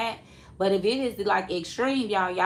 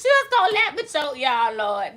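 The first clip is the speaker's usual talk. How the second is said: mock outrage; 5 semitones higher, 3 dB louder than usual. Real, not acted. Teasing friend about choking.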